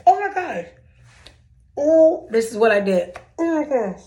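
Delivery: high, loud, hammed-up shock; dramatic gasp. Retelling to viewers, she mocks shocked surprise at her text. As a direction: ham up being stunned by her own words. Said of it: "I'm so dramatic".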